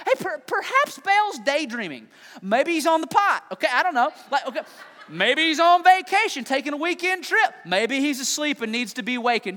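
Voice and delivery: high, loud teasing jabs; trash talk. Retold or imitated. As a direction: taunt them with a grin. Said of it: "Elijah starts talking smack".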